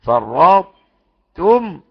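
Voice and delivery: فرطتم drawn out very slowly, ط held into ت; slow careful فرطتم, the ط still heard; partial assimilation. Shown as a demonstration. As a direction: stretch فرطتم, showing the partial merge. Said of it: "إضغام ناقص".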